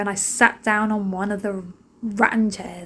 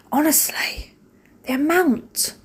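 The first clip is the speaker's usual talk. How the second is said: higher hushed exasperated whisper; whispered complaint; 6 semitones higher, slower than usual. Real, not acted. Mum whispering to viewers about the fortune spent at Pets at Home, exasperated. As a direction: whisper it like a guilty confession. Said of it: "moaning about pet shop spending".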